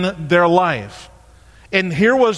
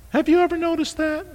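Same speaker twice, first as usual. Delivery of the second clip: voice gets higher; 10 semitones higher, 4 dB quieter, faster than usual. Shown as a demonstration. Preacher voicing Jesus to the rich young ruler, much higher and softer. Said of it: "Did you hear my voice just get real higher?".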